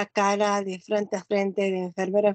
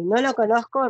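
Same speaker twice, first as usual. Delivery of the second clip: usual speaking voice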